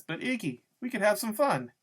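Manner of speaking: high, swooping woman's whine of protest; wheedling. A narrator's reading. Loretta wheedles Iggy in the story.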